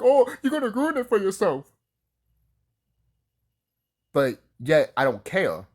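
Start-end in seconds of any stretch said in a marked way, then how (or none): 0.0-1.7 high, loud mocking voice; mocking whiny critic. Mocking fans who warn him, high whiny voice. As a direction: parody the people who nag. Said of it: "people be like".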